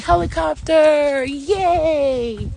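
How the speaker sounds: high, drawn-out kiddie cheer; stretched, high-pitched childlike whoop; playful kid. Retold or imitated. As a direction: sing it out like a gleeful kid at play. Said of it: "You used to be like".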